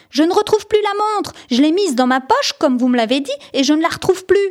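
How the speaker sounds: put-on boy tells the teacher his gold watch is gone, panicked; panicked schoolboy. A narrator's reading. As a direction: blurt out the lost watch in a panic. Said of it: "a crié Geoffroy".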